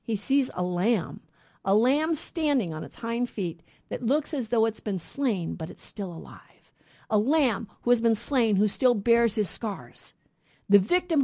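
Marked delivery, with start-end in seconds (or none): none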